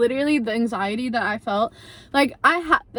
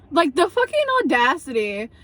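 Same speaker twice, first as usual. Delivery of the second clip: ordinary delivery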